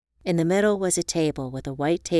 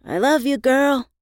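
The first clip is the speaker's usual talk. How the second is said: called back; 8 semitones higher, 5 dB louder than usual. A narrator's reading. Calling back from the boat.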